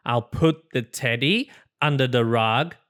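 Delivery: usual speaking voice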